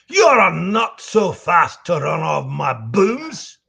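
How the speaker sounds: put-on slow, loud drunk Scottish growl; Demo Man's voice. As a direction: slur it like a drunk Scottish demolition man. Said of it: "Can you say Demo Man's voice".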